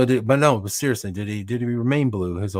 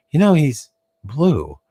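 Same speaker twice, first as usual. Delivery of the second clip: higher, louder, slow dawning realization; wife's realization; 7 semitones higher, 6 dB louder, slower than usual. Retold or imitated. Wife suddenly noticing husband is blue, slow mock realization. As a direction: let it dawn slowly, amused. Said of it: "it was just like".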